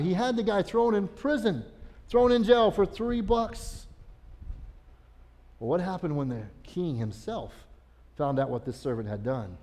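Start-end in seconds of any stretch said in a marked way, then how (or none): none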